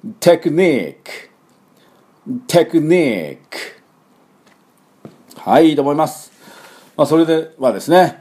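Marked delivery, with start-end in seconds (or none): 0.2-3.6 テクニック as English "technique", slow, high, long "NEEK"; English-style テクニック, strong second syllable; stress on NI. Shown as a demonstration. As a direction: stretch and lift the ニ of テクニック, crisp final k. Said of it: "私の後で発音いきましょ".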